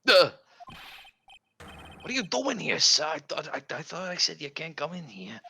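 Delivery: high, startled detective voice. Acted. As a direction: jump at the intruder.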